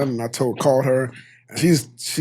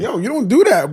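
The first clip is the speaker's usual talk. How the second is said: mock snap; 8 semitones higher, 5 dB louder than usual. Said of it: "can I snap?".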